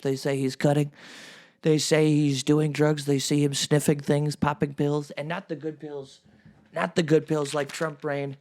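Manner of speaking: ordinary talk, nothing marked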